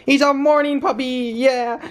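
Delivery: high doggy talk. Real, not acted. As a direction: coo it up at the dog. Baby talk to puppy, repeated.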